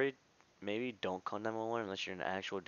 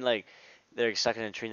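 ordinary delivery

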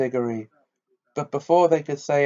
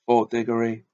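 usual speaking voice

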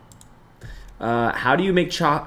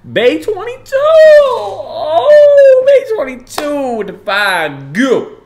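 very high, very loud drawn-out scream; ecstatic shout; 14 dB louder, much slower than usual. Real, not acted. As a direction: scream the donor's name in celebration. Celebrates a big gift.

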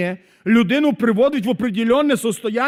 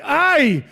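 startled ah yelp; mock cry of ah; 4 semitones higher, 5 dB louder than usual. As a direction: yelp it out in surprise. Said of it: "хтось кричить".

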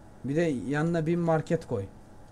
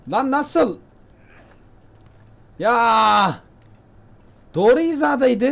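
frustrated outcry; 7 semitones higher, 9 dB louder, much slower than usual. Real, not acted. Missed shot at a rabbit.

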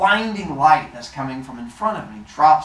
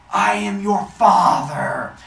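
slow, loud, raised god voice; grand proclamation; 5 semitones higher, 7 dB louder, slower than usual. Acted. Helios reaching out to his son, grand booming declaration. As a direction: declare it hugely, stretching the words.

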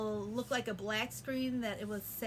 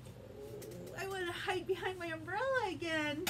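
scared; 7 semitones higher than usual. Shown as a demonstration. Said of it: "show me scared face".

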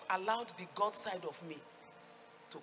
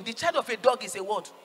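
louder, insistent question to a crowd; loud call-and-response prompt; 8 dB louder than usual. Real, not acted. Preacher wanting to hear the congregation answer, loud prompt. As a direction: raise the volume to draw an answer. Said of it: "I want to hear you".

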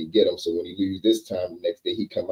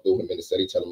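usual speaking voice

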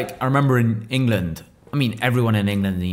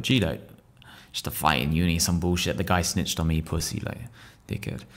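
lower, softer, trailing off; muttered dismissal; 5 semitones lower, 7 dB quieter than usual. Retold or imitated. Telling a stranger at court about the fight, muttered. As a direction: mutter it like it's nothing. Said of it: "I was like".

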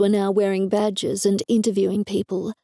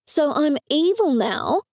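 angry; 6 semitones higher than usual. A narrator's reading. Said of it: "I said angrily".

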